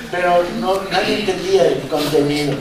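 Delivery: normal talking voice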